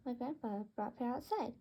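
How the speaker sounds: ordinary delivery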